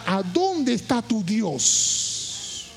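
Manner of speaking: very slow, each word stretched out; slow, searching question. Real, not acted. Preacher challenges people facing obstacles, slow searching question. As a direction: ask it slowly and let the last word hang.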